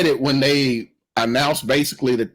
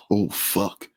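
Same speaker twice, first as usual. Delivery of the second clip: low, quiet acted realization; hushed dread; 8 semitones lower, 6 dB quieter than usual. Voicing Joel and Tess seeing the body, hushed dread. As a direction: drop it under your breath, low.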